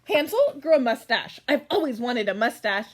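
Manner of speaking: ordinary talk, nothing marked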